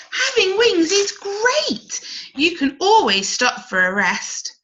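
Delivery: a ladybird showing off her wings; enthusing ladybird. Acted.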